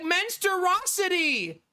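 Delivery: put-on insisting like a frustrated little boy; insistent toddler. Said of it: "he's like".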